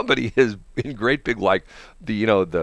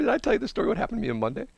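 usual speaking voice

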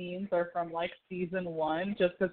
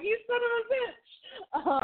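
high, slow mock outrage; mock outraged Donna; slower than usual. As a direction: squeal the insult out slowly. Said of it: "She was like".

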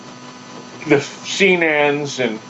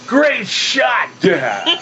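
higher, shouted call across the set; excited kid shout; 7 semitones higher, much slower than usual. Retold or imitated. Boy shouts to his dad after the take, mock cheer. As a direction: yell it out proudly to the whole crew. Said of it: "And I went".

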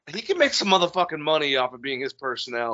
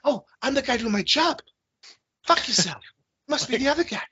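Mark Wahlberg impression; 11 semitones higher than usual. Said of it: "Mark Wahlberg guy".

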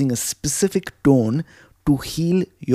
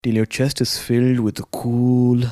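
soft, unhurried guided breathing voice; calm meditative tone; 2 semitones lower, 3 dB quieter than usual. Acted. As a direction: guide them gently through each breath. Leads breathing exercise.

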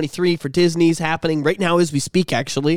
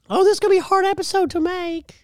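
high falsetto voice; 13 semitones higher than usual. Pretend. Joking about the high drink voice.

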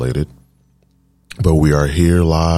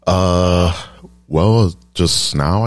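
normal talking voice

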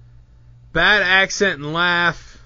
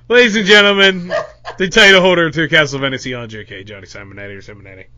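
announcer intro that fades away; mock ring introduction, sinking to a quick low mumble; 6 semitones lower, 4 dB louder, faster than usual. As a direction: start loud and ceremonial, then drop low and trail off. Mock introduction of the title holder.

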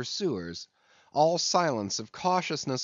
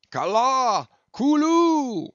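loud, high, drawn-out howl; wild hooting battle howl; howled war cry; 13 semitones higher, 8 dB louder, slower than usual. A narrator's reading. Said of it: "howled Queequeg".

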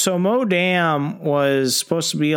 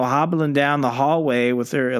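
ordinary delivery

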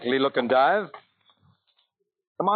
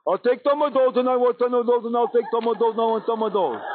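higher, goofy singsong patter; silly idiot voice; 8 semitones higher than usual. Shown as a demonstration. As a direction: demonstrate a dim-witted beggar's chant. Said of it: "you put on that silly look, and you talk like this".

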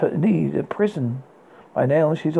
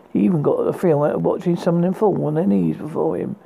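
ordinary delivery, nothing marked

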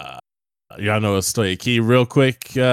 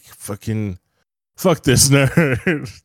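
mock angry; 5 semitones higher, 3 dB louder, much slower than usual. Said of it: "they were like".

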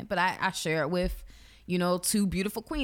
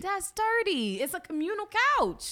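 incredulous disgust; 10 semitones higher than usual. Acted. Woman appalled by girls brought to communal couch, incredulous. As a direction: squeal it in comic disbelief.

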